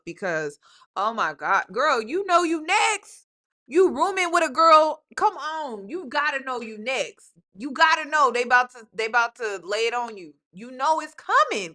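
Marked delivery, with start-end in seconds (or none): 1.7-7.3 emphatic warning. Real, not acted. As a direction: drive home the warning. Reactor warning storyteller.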